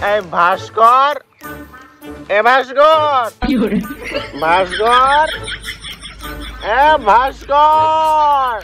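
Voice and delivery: loud drawn-out calls across the field; calling out. Acted. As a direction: call his name, stretching it. Searching, calling friend's name. (জোরে টেনে টেনে ডাকা)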